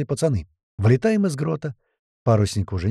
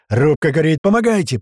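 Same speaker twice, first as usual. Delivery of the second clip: loud, high cry for help; shouted plea; 5 semitones higher, 6 dB louder than usual. Acted. Sailors report fire from above, shouting for help. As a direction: call down urgently for hands. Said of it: "прокричали сверху".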